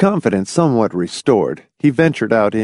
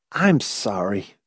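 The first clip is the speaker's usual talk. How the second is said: slow, soft, tired apology; weary; 5 dB quieter, slower than usual. A narrator's reading. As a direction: let the energy drain out. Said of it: "he said wearily".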